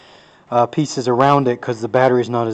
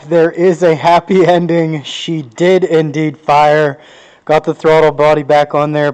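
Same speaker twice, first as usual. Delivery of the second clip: excited; 3 semitones higher, 8 dB louder than usual. Real, not acted. Car finally fired, happy ending.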